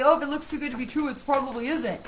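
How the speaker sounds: quick, lightly mocking sing-song; mocking a saying. Reader dismissing a saying she dislikes: light mocking tone. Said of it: "when people say".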